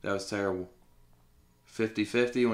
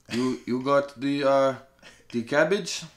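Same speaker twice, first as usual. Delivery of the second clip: a jokey, higher-pitched put-on African accent; lightly hammed African accent asking about the cabbage; mock African accent; 5 semitones higher than usual. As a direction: play up a comic African accent for laughs. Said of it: "your African accent you did earlier".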